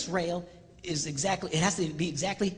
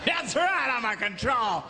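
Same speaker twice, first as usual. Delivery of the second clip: raised, forceful mock boasting; hammed-up bossy shout; proud, domineering; 5 semitones higher than usual. Control person owning the accusation, bullish mock bravado.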